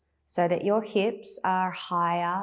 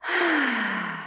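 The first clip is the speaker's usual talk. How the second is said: long falling sigh out through the mouth; demonstrated relaxing exhale; 5 semitones higher than usual. Shown as a demonstration. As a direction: let the breath go in an audible sigh. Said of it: "Sighing it out through the mouth".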